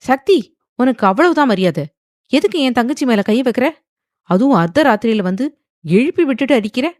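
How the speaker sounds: loud, high, indignant questioning; angry. A narrator's reading. Brother confronts the man who hit his sister, angry. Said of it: "கோபமாக கேட்டுவிட்டு".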